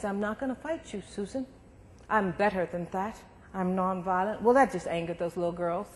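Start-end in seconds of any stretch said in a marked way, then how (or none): 0.1-4.4 put-on much softer, calm child's refusal; quietly and steadily refuse to fight; understated, hushed and firm; soft retold refusal. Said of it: "And I said".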